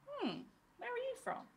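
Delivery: high, soft, intrigued voice; curious stranger. Acted. Acted stranger asking about the accent.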